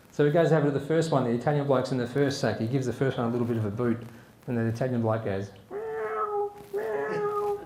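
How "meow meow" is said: very high mewing; fake cat. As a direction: imitate a cat to fool someone. Italian pretending to be a cat.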